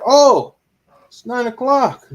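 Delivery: high, loud mock Pakistani accent, slowing on the time; imitating Pakistani friend. Friend in Pakistan saying it's bedtime, mock Pakistani accent. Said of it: "I can't do his accent".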